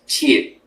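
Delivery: "chie" in Mandarin fourth tone. Shown as a demonstration. Teacher showing students the Chinese word, fourth tone on "chie". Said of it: "es en cuarto tono".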